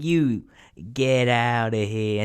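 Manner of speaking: loud, drawn-out mock Italian-American dismissal; Italian impression. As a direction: send them packing, hammed-up Italian-American. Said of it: "That's why I went into my Italian impression".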